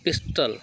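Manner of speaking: "पिस्टल" with hard t and short last vowel; English pronunciation. Shown as a demonstration. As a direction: say "पिस्टल" as pis-tul. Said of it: "अंग्रेजी में होता है पिस्टल".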